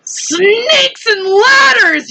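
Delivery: very high, slow panicked yell. A narrator's reading. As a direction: cry out in alarm. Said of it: "yelled the head of the fire department".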